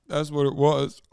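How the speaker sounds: normal talking voice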